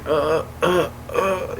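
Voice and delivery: imitated wind-knocked-out grunts; winded groans. Friend fallen from the treehouse, imitated winded groans. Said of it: "like, the wind knocked out of them".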